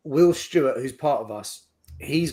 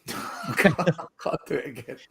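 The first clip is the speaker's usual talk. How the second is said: laughing. Real, not acted. Laughs while refusing.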